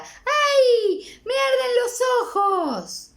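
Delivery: put-on high, loud, drawn-out wail of a kid; child shrieking in the shower, hammed-up; child's yelp. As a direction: cry out as if the soap already stings.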